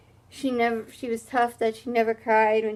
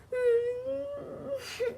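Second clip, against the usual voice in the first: mock sobbing; 13 semitones higher, 7 dB quieter than usual. Mock weeping after flop.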